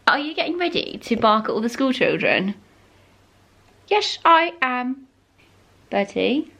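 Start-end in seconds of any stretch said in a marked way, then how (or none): 3.9-5.1 dog voice. Real, not acted. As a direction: answer for the dog, cooing. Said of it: "Sorry about my dog voice".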